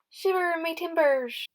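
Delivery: put-on lightly playful pirate exclamation for the kids. Shown as a demonstration. Teacher showing children how pirates talk, mock pirate voice. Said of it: "they would have said".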